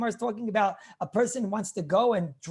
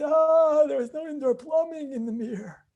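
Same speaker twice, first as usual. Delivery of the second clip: put-on higher, different-timbred elderly rabbi; old rabbi's voice; 5 semitones higher than usual. Rabbi imitates Rav Scheinberg recalling the Mir, higher old voice. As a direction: put on the old sage's voice, laughing at the memory.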